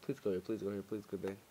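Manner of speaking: hushed pleading. Real, not acted. Begging monster to leave.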